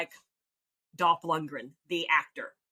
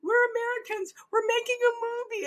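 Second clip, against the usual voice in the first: panicked pleading; 3 dB louder than usual. Retold or imitated. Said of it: "and I'm like".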